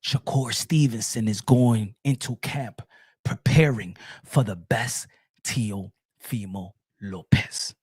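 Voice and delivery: very low, slow delivery with pauses; slow and soft. Shown as a demonstration. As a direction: spell it out slowly, deep and hushed. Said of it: "Let me say it slow".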